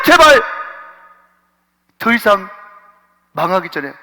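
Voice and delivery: building to a high, loud pleading cry; oratorical plea. Acted. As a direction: orate like a speech, begging them back. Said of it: "정말 웅변처럼". (웅변하듯 점점 높고 크게 애원하며)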